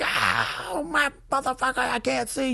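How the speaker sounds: high, strung-out cry in imitation; mock panicked wail. Officer imitating the pepper-sprayed girlfriend running around, mock wail. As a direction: mimic a frantic woman wailing.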